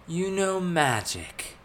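high, drawn-out incredulity; indignant. A narrator's reading. Thales learning of Elizabeth's magic, indignant. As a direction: challenge it with slow indignation. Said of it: "Thales said indignantly".

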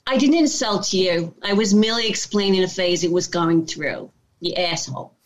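put-on tough Italian-American New Yorker, New York accent; brash New York accent, defensive then cussing. Actress shows hosts her New York audition accent. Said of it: "Can we hear the New York accent?".

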